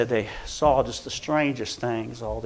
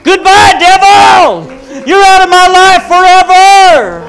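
very high, very loud triumphant shout; ecstatic shout; 19 dB louder, slower than usual. Retold or imitated. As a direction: cry it out to the devil, slowly. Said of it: "started dancing like this".

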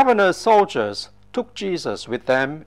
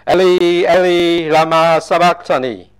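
cried out loud, full and anguished; loud cry; 2 semitones higher, 9 dB louder than usual. A narrator's reading. Jesus crying out from the cross, loud cry. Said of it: "Jesus cried out in a voice".

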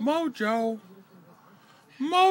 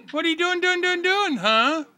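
sing-song teasing; 5 semitones higher, 4 dB louder than usual. Real, not acted. Playful sing-song to the bird.

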